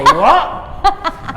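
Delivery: loud, high, incredulous question; baffled barista. Retold or imitated. Starbucks staff failing to understand the order, baffled. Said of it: "They say".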